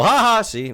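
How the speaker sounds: loud high forced ha ha; fake laugh demo. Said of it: "I laugh when I am emotional".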